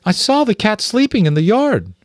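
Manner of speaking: high, bewildered mother mouse; Mrs Frisby puzzled. Acted. Mother mouse to the rats, high and bewildered. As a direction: sound lost, pleading to understand.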